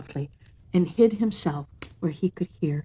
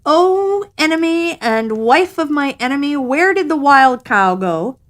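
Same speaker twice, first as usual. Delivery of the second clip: sly cat calling out; 7 semitones higher, 6 dB louder than usual. A narrator's reading. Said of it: "Cat said".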